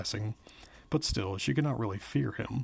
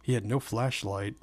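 plain reading voice

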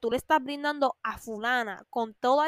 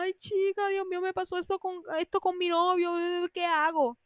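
higher, far-off mock voice of a complaining friend; whiny friend; 6 semitones higher than usual. Mocking the friend who calls about her boyfriend, whiny plea. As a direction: play the dramatic friend begging for advice.

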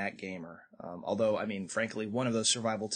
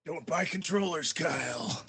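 Batman voice; 4 semitones higher than usual. Pretend. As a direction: growl a menacing warning. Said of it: "in the Batman voice".

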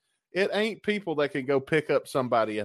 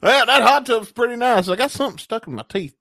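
smug satisfied guy; 9 semitones higher, 7 dB louder than usual. Pretend. Acting out himself leaving tub.

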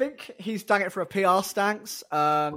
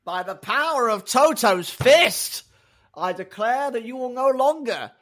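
grand, drawn-out proclamation, higher and louder; mock heroic decree; 6 semitones higher, 6 dB louder, slower than usual. Podcaster playing team boss issuing a decree, grandly declaimed. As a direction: declaim it like an all-powerful ruler.

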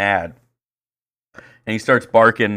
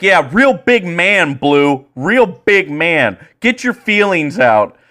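loud, high, drawn-out sarcastic taunt; jeering heckle; 8 semitones higher, 5 dB louder, much slower than usual. Retold or imitated. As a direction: imitate a coach taunting the umpire, stretching the words. Ejected coach heckles while walking away.

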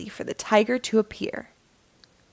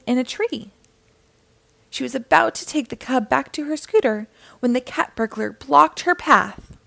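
usual speaking voice

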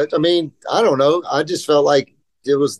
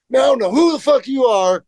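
high, defiant little hothead, lightly voiced; a bratty kid mouthing off, pitched up; kid hothead snapping; 10 semitones higher than usual. Young skater to a pro he doesn't recognise, bratty and defiant. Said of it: "little hothead".

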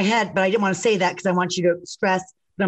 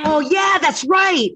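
excited shout; 7 semitones higher, 4 dB louder, slower than usual. Real, not acted. Sudden loud recall.

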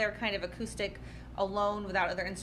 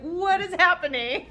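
laughing disbelief; 9 semitones higher, 7 dB louder than usual. Retold or imitated. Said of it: "you sounded like a news anchor".

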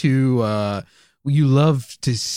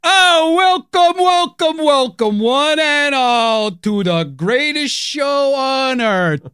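ringmaster; 8 semitones higher, slower than usual. Acted. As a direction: bellow it like a circus showman opening the tent. Hammed ringmaster welcome.

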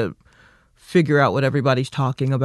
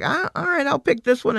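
retold giving in; 11 semitones higher than usual. Retelling giving in to app setup.

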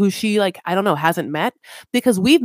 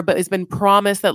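ordinary talk, nothing marked

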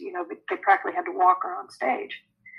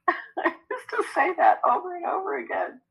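higher voice breaking into laughter; laughing; 10 semitones higher than usual. Real, not acted. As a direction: say it through helpless chuckles. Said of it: "I'm sorry to laugh".

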